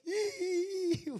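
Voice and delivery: high mock sob sound; imitated crying wail. Pastor mimics his own sobbing, high wail.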